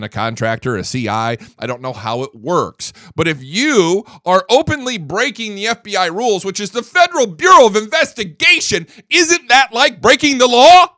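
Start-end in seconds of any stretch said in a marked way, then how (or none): 3.2-10.9 rising outrage. Real, not acted. Building outrage on radio.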